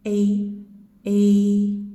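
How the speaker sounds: loud, long held "A". Shown as a demonstration. Teacher demonstrating the long diphthong vowel. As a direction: hold the mouth still and stretch A. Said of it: "double the short vowel length".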